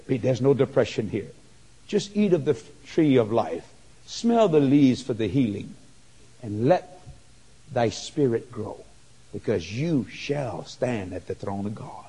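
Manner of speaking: tender reassuring fade; gentle comforting voice. Retold or imitated. Person comforting depressed Pete.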